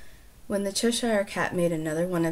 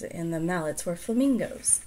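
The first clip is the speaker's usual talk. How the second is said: ordinary delivery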